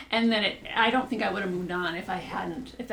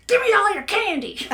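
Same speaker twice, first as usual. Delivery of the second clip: catchphrase voice; 9 semitones higher, 5 dB louder than usual. Shown as a demonstration. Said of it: "catchphrase is".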